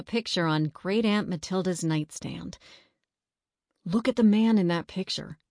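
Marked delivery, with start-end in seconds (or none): none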